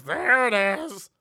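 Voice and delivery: put-on slow drunk drawl. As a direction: drag it out after puking. Drunk after puking.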